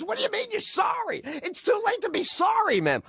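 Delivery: very high indignant squawk; hammed-up, high-pitched exasperation; angry comic. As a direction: imitate a comic's outraged comeback. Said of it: "like he's angry and afraid".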